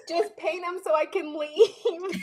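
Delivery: high, slow mock-tearful whine; exasperated plea. Worn-out customer to nail tech, whiny mock plea. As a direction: whine it, half laughing, drawn out. Retells begging to be finished.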